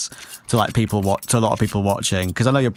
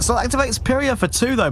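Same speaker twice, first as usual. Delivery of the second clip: excited; 12 semitones higher than usual. Real, not acted. Play-by-play big moment.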